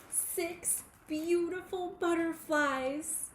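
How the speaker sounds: high, slow marvelling reveal; wonder-filled reveal. A narrator's reading. Revealing the butterflies to the children, high and slow with wonder. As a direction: unveil it with wonder.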